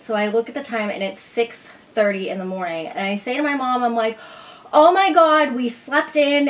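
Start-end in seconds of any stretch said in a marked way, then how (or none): 4.7-6.5 high, loud panicked cry. Retold or imitated. Waking late and panicking to mom, loud and high. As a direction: blurt it out in a panic. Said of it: "and I'm freaking out".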